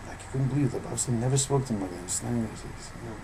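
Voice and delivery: ordinary delivery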